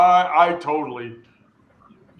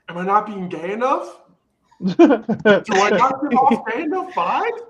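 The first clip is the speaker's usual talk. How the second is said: flamboyant mock panic; 6 semitones higher, 5 dB louder than usual. Retold friend's dramatic reaction.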